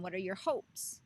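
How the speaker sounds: ordinary delivery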